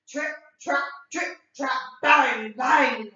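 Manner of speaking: stomp the words like big hooves; big goat stomping over the bridge; heavy bangs. A narrator's reading.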